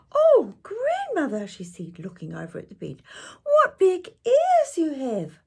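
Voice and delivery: very high, loud, amazed cry; astonished girl. Acted. Girl staring at the wolf in bed, amazed. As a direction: gasp it out in wonder.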